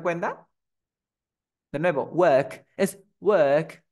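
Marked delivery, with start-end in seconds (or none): 2.0-3.8 "work" lento, vocal larga y sin R; work británico. Shown as a demonstration. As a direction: alarga "work" callando la R. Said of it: "pronunciar en británico". ("work" a la británica, vocal neutra sostenida)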